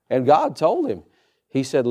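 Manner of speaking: ordinary talk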